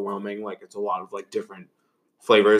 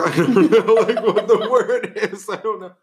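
words through laughter, higher; laughing; 12 semitones higher, 4 dB louder than usual. Real, not acted. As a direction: giggle through the line. Laughing, can't find word.

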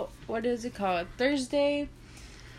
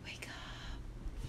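soft whispered wake-up call; 17 dB quieter than usual. Retold or imitated. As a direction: whisper it gently. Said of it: "she's like".

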